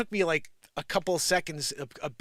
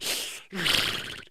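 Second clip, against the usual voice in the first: unvoiced horse blow; imitated horse snort. Imitates the horse after "you go.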